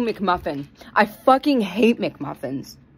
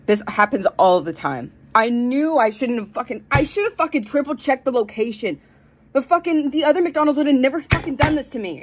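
ordinary talk, nothing marked

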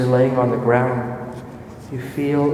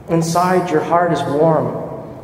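normal talking voice

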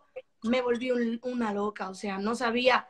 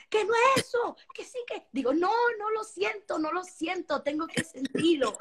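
frustrated insisting; 8 semitones higher than usual. Retold or imitated. Retelling arguing in the studio.

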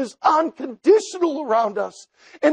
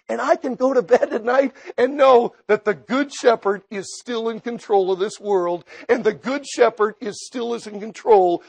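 preaching; 3 semitones higher, 5 dB louder than usual. Acted. Preacher builds to full force.

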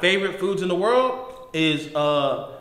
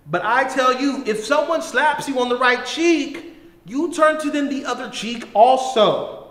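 scripture reading; 5 semitones higher than usual. Acted. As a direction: proclaim the verses with rising force. Reads Matthew five aloud.

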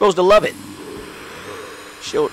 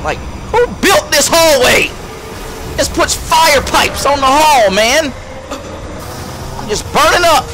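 frantic shouting; 9 semitones higher than usual. Real, not acted. Gamer running through fire pipes, frantic high shouting. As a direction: yell while dodging fire.

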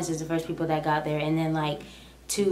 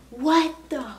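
high, startled half-curse; shocked cussing; 10 semitones higher than usual. Retold or imitated. Stunned driver cursing after the BMW hits her car. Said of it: "I actually cussed".

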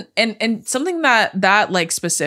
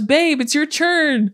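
mock husband; 7 semitones higher, slower than usual. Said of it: "he runs up and he goes".

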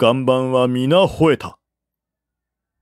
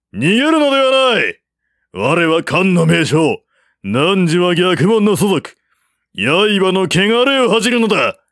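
haughty retort; 7 semitones higher, 4 dB louder than usual. A narrator's reading. Officer proudly scorning the general, haughty loud retort. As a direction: proclaim his noble contempt.

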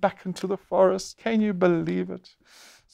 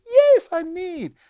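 [name] replying; 13 semitones higher, 4 dB louder, slower than usual. Pretend. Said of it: "[name], do you need tortoises?".

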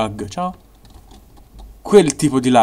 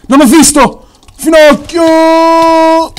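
shout; 12 dB louder, much slower than usual. Real, not acted. Rage shout at game.

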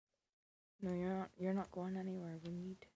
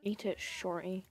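loud command; 8 dB louder than usual. Real, not acted. Feeding toddler in game.

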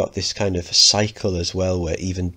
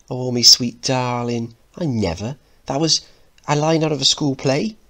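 mocking Mickey; 6 semitones higher, 4 dB louder than usual. Mother teasing her son.

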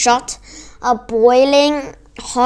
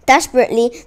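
usual speaking voice

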